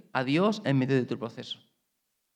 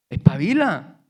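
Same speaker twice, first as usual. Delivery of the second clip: scolding call; 9 dB louder, slower than usual. Acted. Preacher scolds Saul.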